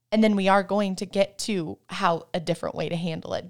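normal talking voice

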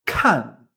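kan loud, falling sharply, with a puff of air; fourth tone, aspirated. Shown as a demonstration. Teacher modelling the example, kan in an aspirated falling tone. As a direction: push air out on kan and drop the pitch. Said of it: "¿Notas que sale aire?".

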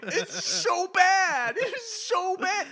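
high, loud, laughing disbelief; exasperated rant. Real, not acted. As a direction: wail it in amused outrage at terrible writing. Exasperated rant about bad dialogue.

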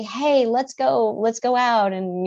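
slow, high, sing-song coaxing; bright, drawn-out let's-go-have-fun pitch; cheery distraction. Retold or imitated. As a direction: lure them off to get ice cream. Cheering up a sad friend.

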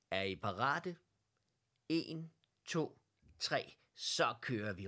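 loud countdown to the start; råbt startsignal. A narrator's reading. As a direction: call out the start, drawing out the last words. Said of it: "råbte hr". (højt råbt startsignal)

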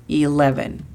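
"Eleven" with a clear English L; "Eleven" modelled for the class; careful English eleven. Shown as a demonstration. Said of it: "la pronunciación de la L".

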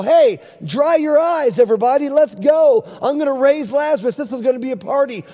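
imagined pep talk, high and eager, easing at the end; upbeat rallying call. Acted. Imagined Jesus rallying the mourners.

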